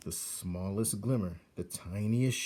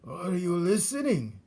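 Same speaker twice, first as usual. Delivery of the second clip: put-on higher, sly old rat voice; a sneering old rodent; old rat Botticelli; 9 semitones higher, 6 dB louder than usual. Old rat mocks young rat's idea of light, sneering.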